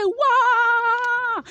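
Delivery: high wailing waouh; retold cry. A wife wails as she relives the shock. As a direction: let out a high wail. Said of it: "J'ai crié".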